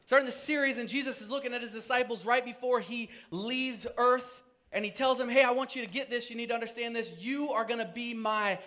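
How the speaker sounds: high, loud preaching voice; rising pulpit fervour. Acted. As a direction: preach it out to the congregation. Preaching at full force.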